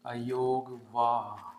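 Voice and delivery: अयोगवाह drawn out very slowly, syllable by syllable; slowly spelled out. Shown as a demonstration. Teacher introducing the new topic अयोगवाह, very slow. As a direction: stretch अयोगवाह out slowly and softly. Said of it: "हम पढ़ेंगे".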